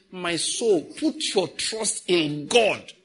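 very slow, drawn-out; slow psalm recitation; slow quoted prayer. Retold or imitated. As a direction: stretch the words like a psalm. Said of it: "You hear David saying".

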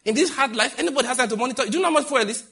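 incredulous loud retort; retorting to a caller. Retold or imitated. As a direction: ask it high and loud, almost mocking. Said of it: "I said".

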